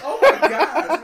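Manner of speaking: surprised. Real, not acted. Reacting to a fake death report.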